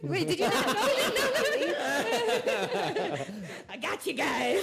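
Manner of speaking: high, giggly teasing through laughter; laughing tease. Real, not acted. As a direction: laugh while you tease him. Teasing co-host about IRL.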